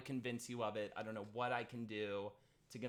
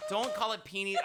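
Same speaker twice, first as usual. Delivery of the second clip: sharp, high protest over the name; loud laughing objection; 9 semitones higher, 5 dB louder than usual. Real, not acted. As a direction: push back hard on the nickname. Protesting the nickname, laughing fight.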